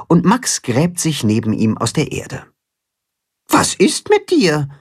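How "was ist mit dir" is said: hohe, besorgte Hühnerstimme; fürsorglich nachfragen, Stimme nach oben; besorgt. Acted. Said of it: "fragt Timme besorgt". (Timme fragt den traurigen Karpfen besorgt)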